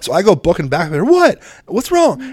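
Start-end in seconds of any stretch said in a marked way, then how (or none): 1.0-2.2 high, louder worried call; father calling out to a crying son, high and alarmed. Retold or imitated. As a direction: shout down the hall in alarm. Calling out to hurt child.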